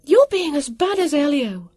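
high, cross scolding; exasperated telling-off; turning on someone. A narrator's reading. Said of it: "She turned on Jonathan".

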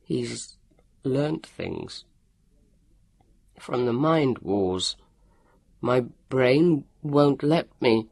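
slow, halting, helpless excuse; pathetic. A narrator's reading. Captive Leon explaining to Elio, pathetically. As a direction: struggle through it word by word. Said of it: "Leon's voice said pathetically".

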